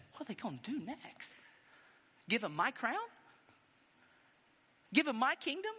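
hushed start rising to high questions; indignant king. Acted. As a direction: build from muttered disbelief to incredulous protest. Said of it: "Saul said".

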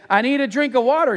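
put-on high, insistent child's demand; shooting back. Daughter answering dad's order, high defiant comeback. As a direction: fire it back like a stubborn little girl. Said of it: "she shot back".

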